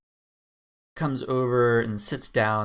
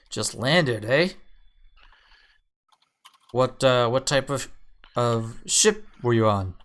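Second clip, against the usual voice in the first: half-orc Gorvy; 3 semitones higher, 6 dB louder than usual. Acted.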